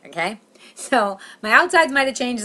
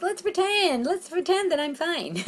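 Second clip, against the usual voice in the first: fake-cheerful self-talk; 11 semitones higher, slower than usual. Comic urging herself to act fine, fake-cheerful self-talk. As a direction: pitch it up high and draw it out.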